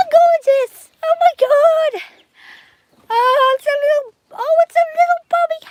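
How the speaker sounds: high, loud cooing at a newborn. Real, not acted. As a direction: melt over the little one. Greeting a just-hatched baby.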